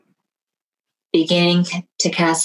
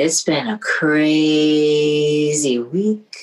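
ordinary talk, nothing marked

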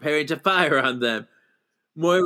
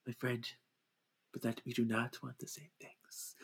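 much softer, hushed and gentle; quiet refusal; 2 semitones lower, 18 dB quieter than usual. Retold or imitated. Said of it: "And he's like".

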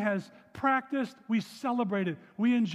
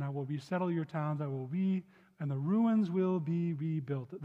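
measured, solemn Bible reading; scripture reading; 5 semitones lower than usual. Acted. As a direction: read the verse out to the congregation. Reads scripture aloud.

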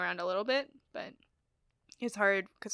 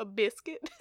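southern-tinged "biscuit"; southern accent; 3 dB quieter than usual. Shown as a demonstration. Showing what is hard to avoid, southern accent. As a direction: let biscuit slip into southern. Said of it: "so hard not to do a".

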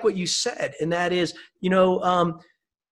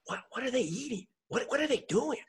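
soft wondering voice, pitched up; curious whisper; 6 semitones higher, 10 dB quieter than usual. Retold or imitated. People quietly wondering what a happy couple does. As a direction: hush it like a curious aside. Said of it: "great for people to go".

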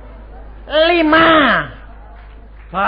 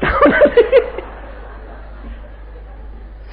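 real laugh. Real, not acted. Preacher laughs at the punchline.

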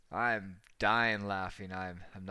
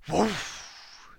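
loud, high "woof" burst; explosive mock fireball noise; imitated whoosh of ignition; 9 semitones higher, 8 dB louder than usual. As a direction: go up like fuel catching fire.

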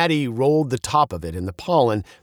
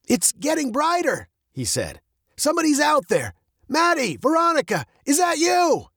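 higher, hopeful call into the dark forest; dad calling his daughter from the treetop, higher voice; Veronica's dad calling out. Acted. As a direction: call out to the girls, rising toward the question.

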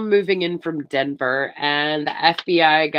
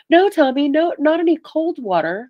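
put-on high, louder girlish objection; playful protest; 10 semitones higher, 4 dB louder than usual. Woman in the bath to her boyfriend, high mock protest.

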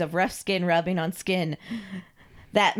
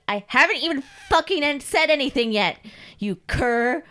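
loud, raised, scornful rebuke; irritated mistress; 7 semitones higher, 5 dB louder than usual. Acted. Mistress cuts off fawning Carl, irritated. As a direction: snap at a grovelling servant.

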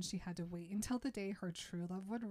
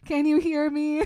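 very loud, high mock holler; shouting to someone inside; 8 semitones higher, 16 dB louder than usual. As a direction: holler as if to someone trapped in a stomach. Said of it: "talks to her stomach".

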